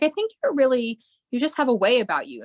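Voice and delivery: high, quick, admiring voice; mock admiring friend. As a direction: play the impressed friend, lightly. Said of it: "She's like".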